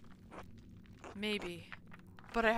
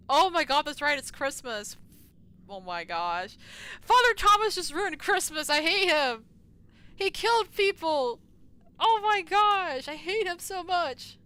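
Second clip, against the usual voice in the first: high, loud mock-outraged rant; indignant outburst; 6 semitones higher, 10 dB louder than usual. Real, not acted. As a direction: rage that he ruined Christmas. Indignant rant about ruined Christmas.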